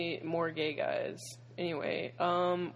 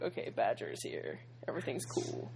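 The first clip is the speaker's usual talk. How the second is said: relieved hush; 8 semitones lower, 8 dB quieter than usual. Retold or imitated. Retold relief.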